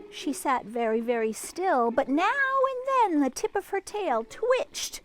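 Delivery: lively storybook read-aloud; reading to children. A narrator's reading. Librarian reading to children, lively storybook voice. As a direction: read it like a picture book to kids.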